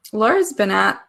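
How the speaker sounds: ordinary talk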